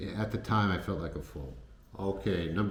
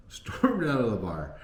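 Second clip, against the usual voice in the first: laughing the words out, higher and louder; laughing repeat; 4 semitones higher, 4 dB louder than usual. Real, not acted. Laughing as he repeats that she stormed out. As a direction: chuckle through the repeat.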